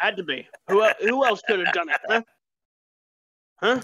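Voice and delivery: paranoid NPC voice. Acted.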